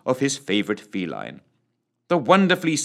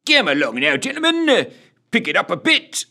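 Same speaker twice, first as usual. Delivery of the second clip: high-pitched sergeant's bark; brusque bark; 10 semitones higher, 4 dB louder than usual. A narrator's reading. Sergeant hurrying his platoon into line, brusque bark. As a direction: chivvy the men briskly, pitched well up. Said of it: "barked Sergeant Roger Wilco Rogers".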